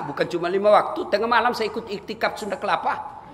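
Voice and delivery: usual speaking voice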